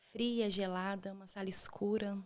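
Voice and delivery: usual speaking voice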